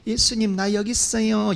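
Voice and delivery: soft, timid "here I am"; quiet calling. Shown as a demonstration. Blind man calling Jesus too softly, timid. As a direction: say it small and shy, the wrong way. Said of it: "이런 게 아니라".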